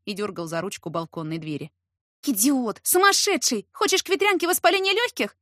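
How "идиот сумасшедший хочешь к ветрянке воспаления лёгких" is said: high, loud, indignant scolding; angry outburst. A narrator's reading. Heroine scolds guest out in the cold night, angry. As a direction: blurt it out angrily, pitch climbing sharply. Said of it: "выпалила я разгневанно".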